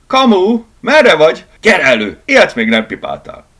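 loud, high call to a crony; boisterous, excited summons; shouting. Acted. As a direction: holler for your pal to come out. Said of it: "kiáltotta".

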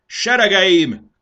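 high, long, booming call; drawn-out commander's yell; shouted address. A narrator's reading. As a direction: summon the whole army with a shout. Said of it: "kiáltotta".

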